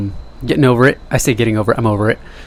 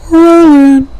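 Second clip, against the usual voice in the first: much higher and louder, "rolling" shouted out; hype shout; 10 dB louder than usual. Pretend. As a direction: belt it like kicking off a show. Hyping himself up for the camera.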